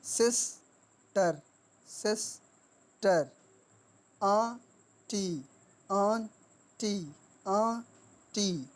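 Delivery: Sister and aunty slowly, a clear gap inside each; two-syllable split. Shown as a demonstration. Teacher showing two sounds in sister and aunty, halves separated. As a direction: break them as सिस-टर, आं-टी. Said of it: "दो sound हैं इनमें".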